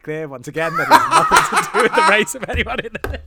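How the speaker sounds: breaking into loud laughter while talking; laughing. Real, not acted. Host making a disclaimer to co-host, laughing. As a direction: push the disclaimer out through rising laughter.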